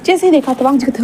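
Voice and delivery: ordinary delivery, nothing marked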